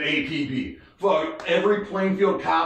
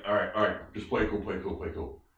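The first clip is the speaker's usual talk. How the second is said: low, soft undertone; hushed urging; 9 semitones lower, 8 dB quieter, faster than usual. Retold or imitated. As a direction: keep it down, urging calm under your breath. Buddy nervously coaching calm at stop.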